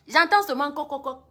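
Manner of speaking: faint knocking heard from the room. Retold or imitated. Imitating knocking at door.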